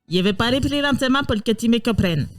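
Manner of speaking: hammed-up Italian-accented French. Player answering the police chief, mock Italian accent. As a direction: put on a joke Italian accent. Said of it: "Bon, bah, italien, ça passe".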